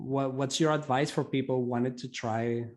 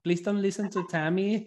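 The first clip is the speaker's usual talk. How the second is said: laughing; 5 semitones higher than usual. Real, not acted. Teasing guest, laughing.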